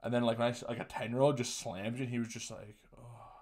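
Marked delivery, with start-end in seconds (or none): 3.0-3.3 tiny, very soft deflated sigh of a word; understated wounded little oh; mock heartbroken. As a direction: imitate being crushed, barely audible. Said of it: "so heartbroken by it".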